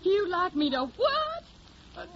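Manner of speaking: put-on slow, high Southern-accented puzzlement; put-on hillbilly accent. Hildy asks the record man what he means, in a high, slow Southern drawl. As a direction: play dumb country girl, high and drawn out. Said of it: "I got the technique down pat".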